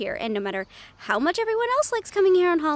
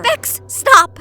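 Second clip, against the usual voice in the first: yelled much higher and louder; urgent shout; 8 semitones higher, 13 dB louder than usual. Acted. Host yelling at Bex to stop, urgent shout. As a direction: shout the warning with full urgency.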